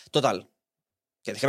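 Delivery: ordinary talk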